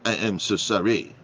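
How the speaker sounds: imitated French waiter apologizing; French accent. French waiter comes back to apologize, French accent. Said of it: "So the waiter goes in this French accent".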